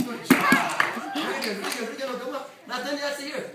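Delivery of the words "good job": very high, loud cheer; excited praise. Real, not acted. Coach praising student after the yell, high excited shout. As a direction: cheer them on with delight.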